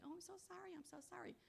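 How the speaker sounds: high, soft, gushing fake sorry; mock over-apologizing. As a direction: imitate a needless, fussy apology in a high voice. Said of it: "that attitude of saying".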